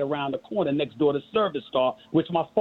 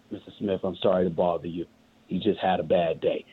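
apologetic officer; 11 semitones lower than usual. Retold or imitated. Officer apologizing to him after recognizing him, lower and sheepish. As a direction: play the cop backing down politely.

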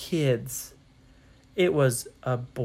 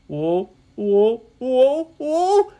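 loud whoas climbing higher and higher; rising alarm; 8 semitones higher, 8 dB louder, slower than usual. Acted. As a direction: build from alarmed to panicky. Ryan sees giant toothbrush falling.

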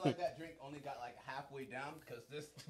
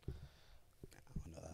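whisper; 8 semitones lower, 16 dB quieter, much slower than usual. Real, not acted. Aside while co-host jokes.

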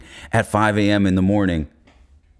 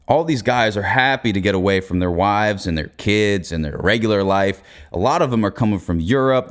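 ordinary delivery

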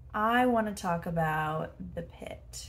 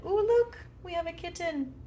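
high, excited squeal at spotting a kitten; light up as the kitten appears; ooh, look, sudden excited cooing at a kitten; delighted surprise; 10 semitones higher than usual. Real, not acted. Kitten walks into her bedroom.

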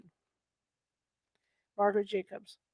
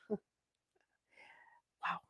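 whispered wow; 17 dB quieter than usual. Real, not acted. Amused aside while reading.

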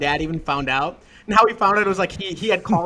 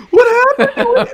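loud, very high-pitched retold alarm; mock-horrified squeal of a question; mock shock; 9 dB louder than usual. Retelling shock at dead fish.

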